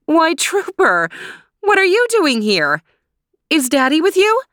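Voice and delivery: surprised mom fussing over a dog; high-voiced mother.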